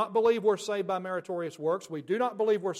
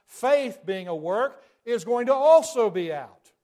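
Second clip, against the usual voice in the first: loud and higher, hammering the conclusion; forceful preaching; 4 semitones higher, 10 dB louder than usual. Real, not acted. Preacher hammering home faith as work, loud and pitched up. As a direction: drive it home to the crowd, loud.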